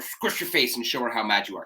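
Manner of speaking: usual speaking voice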